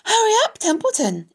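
mocking high-pitched imitation; Wilbur. A narrator's reading. Rat throws the pig's plea back, mocking imitation. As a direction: mimic the pleading, high and fast. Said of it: "He began imitating Wilbur's voice".

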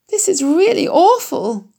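high, slow little pig voice, near tears; frightened piglet voice. A narrator's reading. Piglet Wilbur cornered in the farmyard, high and frightened. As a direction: whimper it like a scared baby pig. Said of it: "thought Wilbur".

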